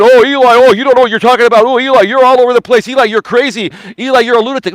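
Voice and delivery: high, loud, fast whining voice mocking critics; whiny mock complaint. Mocking the critics who call him crazy, whiny high voice. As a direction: whine it out like a mocking impression. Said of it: "You can cry and whine and complain".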